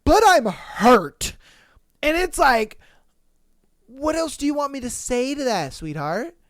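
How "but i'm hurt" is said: slow, louder, higher mock whine; a hint of mock hurt. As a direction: drag it out like a pouting sulk. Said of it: "she's just like".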